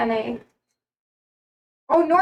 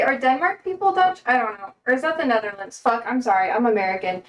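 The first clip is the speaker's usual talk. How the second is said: normal talking voice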